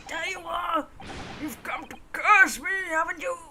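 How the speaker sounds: mildly frantic acted accusation; accusing, haunted prisoner. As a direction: confront a phantom in a character voice.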